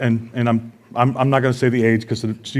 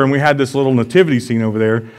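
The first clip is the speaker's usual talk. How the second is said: ordinary talk, nothing marked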